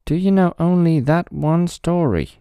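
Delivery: high, loud sneering rat; scornful rat voice. Acted. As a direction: rats scoffing at the story. Rats question the tree.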